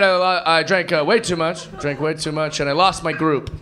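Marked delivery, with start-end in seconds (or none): none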